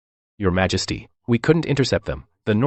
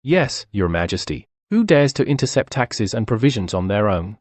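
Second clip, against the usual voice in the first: normal talking voice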